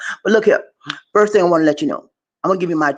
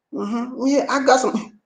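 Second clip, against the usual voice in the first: ordinary talk, nothing marked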